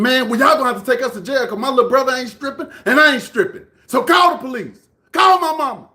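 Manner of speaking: high, loud imitation of a kid refusing; heated brother's outburst, mimicked; retold defiant shout. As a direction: act out yelling back at the grown man.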